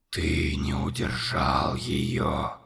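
very low, slow, whispered accusation; whispered monster. A narrator's reading. Monster whispering accusation to Conor. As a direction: hiss the accusation.

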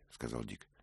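usual speaking voice

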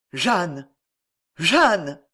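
a touch of delirious anguish; anguished stammer. A narrator's reading. François recognising that night's voice, anguished stammer. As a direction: call her name in mounting dread. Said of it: "bégaya François dans un délire d'angoisse".

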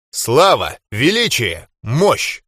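ordinary delivery

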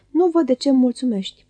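plain reading voice

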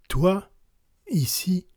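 surprised recognition. A narrator's reading. Young general amazed to find the stranger here, surprised. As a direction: catch your breath at seeing an old friend.

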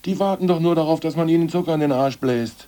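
loud, high, furious rant; angry outburst. Acted. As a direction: spit it out in disgust. Angry outburst about the farmers.

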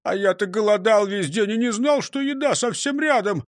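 high-pitched, wailing complaint; loud cry easing into plaintive grumbling; lamenting. A narrator's reading. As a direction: bemoan it with comic woe. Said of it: "запричитал он".

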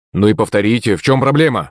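ordinary delivery

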